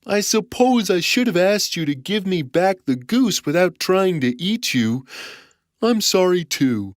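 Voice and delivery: lightly voiced forgiving giant; apologetic giant. A narrator's reading. Giant accepting Jack's apology, lightly apologetic. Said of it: "said the giant".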